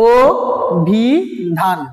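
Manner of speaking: অভিধান very slow in three separated syllables, "o, bhi, dhaan"; pronunciation demo. Shown as a demonstration. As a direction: break অভিধান into held syllables starting on closed o. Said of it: "এটা কি".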